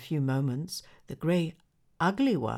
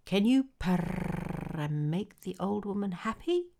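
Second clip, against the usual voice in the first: a hint of a haughty cat; lightly voiced disdainful cat; snooty bored cat; 5 semitones higher than usual. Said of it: "asked the cat".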